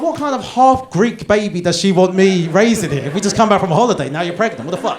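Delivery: loud, high ranting disbelief; mock outrage. Husband ranting about the holiday baby, loud mock outrage. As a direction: rant it, half laughing. Retold inner rant, loud.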